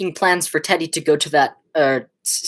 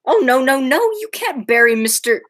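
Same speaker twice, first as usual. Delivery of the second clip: louder and higher, frantic no no no; frantic protest; 5 semitones higher, 4 dB louder than usual. Acted. Nephew begging the aunts not to bury the body, loud and frantic. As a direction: stop them in a rising panic.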